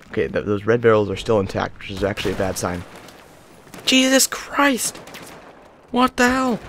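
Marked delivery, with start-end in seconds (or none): 3.9-6.6 much higher, drawn-out startled cry; shocked at what he sees; high slow Jesus Christ; alarmed exclamation. Real, not acted. Sudden danger in game.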